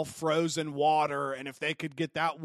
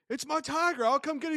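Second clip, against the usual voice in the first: higher, casual unbothered voice; lightly voiced tiger owner; 10 semitones higher than usual. Tiger owner calmly answering the panicked neighbor, casual. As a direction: shrug it off like nothing's wrong. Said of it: "the guy's like".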